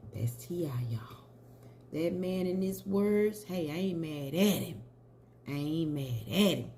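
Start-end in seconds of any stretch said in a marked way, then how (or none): 0.1-1.2 whisper. Real, not acted. Attributes the statement she read.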